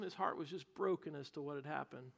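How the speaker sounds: usual speaking voice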